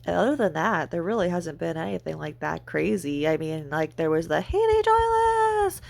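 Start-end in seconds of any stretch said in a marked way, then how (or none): 4.4-5.8 high, drawn-out mock scream of the name. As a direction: shriek the name, slow and high. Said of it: "that's her screaming my name".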